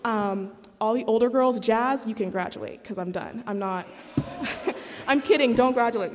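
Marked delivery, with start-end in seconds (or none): 4.4-6.1 laughing it off, a bit louder. Real, not acted. Joking to the older girls, laughing. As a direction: blurt it out through a real laugh.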